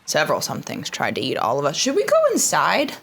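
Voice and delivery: ordinary talk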